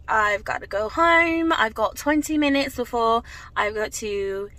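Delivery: badly faked European countryside accent; terrible European accent. Vlogger wrapping up to go home, in a terrible European countryside accent. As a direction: put on a playful, bad rural European accent. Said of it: "That was a terrible accent".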